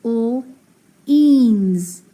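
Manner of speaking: l alone, pause, then a loud drawn-out eans; l ... eans, in separate pieces; sounded out. Shown as a demonstration. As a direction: split l from eans for a learner. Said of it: "And then I have".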